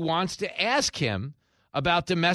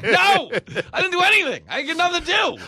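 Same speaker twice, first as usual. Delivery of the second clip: much higher, louder frantic protest; panicked denial; 8 dB louder than usual. Pretend. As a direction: plead innocence in a panic. Mock panicked denial at meeting.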